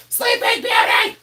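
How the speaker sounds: drawn-out, very high squealing yell; mock yell. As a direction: parody every reseller yelling it out. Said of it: "they all yell the same thing".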